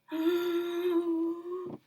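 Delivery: sharp high gasp; woman gasping in fear; frightened gasp. Acted.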